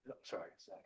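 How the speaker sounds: whispered apology, choking up; barely getting the words out; choked up. Real, not acted. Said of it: "I'm just choking up very bad".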